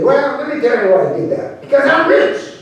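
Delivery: high, excited brag; boastful. Acted. Vaquero explaining himself to the trail boss, boasting high.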